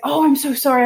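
high, loud mock-mortified apology; imitated apology. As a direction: gush an embarrassed sorry. Imitates embarrassed people apologising.

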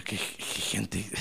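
very low, soft, slow mutter; muttered disapproval. Retold or imitated. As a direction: mutter it slowly to yourself. (murmullo grave de desaprobación)

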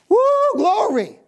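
high, loud burst of praise; ecstatic cry. Real, not acted. Preacher overcome by praise mid-sermon, crying out high and loud. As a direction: let it break out, overcome.

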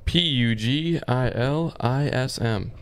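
spelled out letter by letter, slow; spelling it out. Shown as a demonstration. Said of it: "Can you spell it?".

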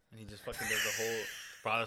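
ordinary talk, nothing marked

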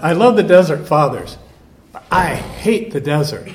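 put-on half-hearted Southern accent of a homesick monk; imitating Georgian Southern accent. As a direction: lightly mimic a Georgia monk's Southern accent, then drop it. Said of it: "he had a very Georgian accent".

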